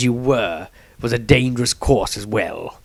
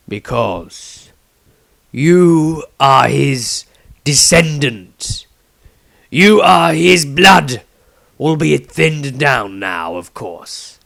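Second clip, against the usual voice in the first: slow, louder solemn revelation; solemn old Patriarch; 4 semitones higher, 7 dB louder, slower than usual. A narrator's reading. Patriarch tells Maestro his lineage, slow and solemn. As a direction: lay it on him slowly, weighty.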